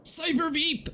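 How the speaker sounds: very high squeaky announcement of the name. Pretend. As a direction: squeal the name like a tiny hype man. Chanting the new name.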